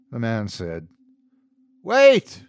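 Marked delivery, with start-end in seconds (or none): 1.8-2.4 high, loud urgent cry; cried out. A narrator's reading. Priest calls out to stop the departing man, urgent cry. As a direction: call out to stop him. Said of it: "I cried".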